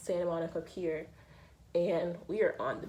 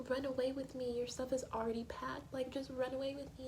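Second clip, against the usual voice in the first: higher, soft earnest plea; pleading boyfriend; 7 semitones higher, 5 dB quieter than usual. Retold or imitated. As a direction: beg her to believe it. Jess begging Rory to leave.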